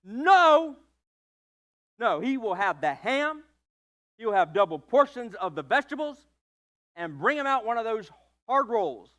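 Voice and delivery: loud, firm parents overruling the order; a hint of stern parents correcting the waitress; bossy parents. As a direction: cut the kid off and insist. Both parents overrule the boy.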